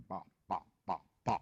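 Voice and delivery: choppy robotic syllables, one by one. Shown as a demonstration. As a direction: chop each syllable like a robot. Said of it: "you speak like a robot".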